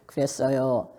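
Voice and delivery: low, sinking, falling delivery; subdued low-pitched reply. Shown as a demonstration. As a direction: drop the voice and let it fall. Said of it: "틀리들 하는 거지".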